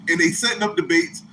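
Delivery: normal talking voice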